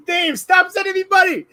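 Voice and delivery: high excited shout; laughing, high-pitched call to a donor; playful protest. Real, not acted.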